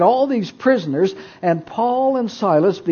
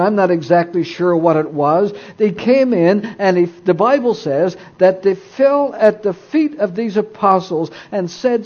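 ordinary delivery

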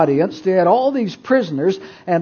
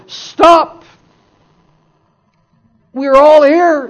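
loud, high urgent call; Paul; 9 semitones higher, 10 dB louder, slower than usual. Retold or imitated. Paul calling to the prison guard, urgent shout. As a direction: call out loudly to halt someone. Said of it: "Paul called out to him".

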